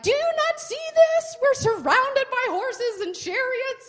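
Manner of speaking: high, panicked servant; freaking out. Retold or imitated. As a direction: squeal it out in alarm. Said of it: "The servant starts freaking out".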